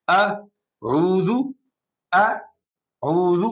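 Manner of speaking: very slow اعوذ broken into آ and عوذ; اعوذ stretched in separate syllables; split syllables. Shown as a demonstration. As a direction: drill it apart slowly, آ then عوذ. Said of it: "آپ توڑ کے پڑھیں".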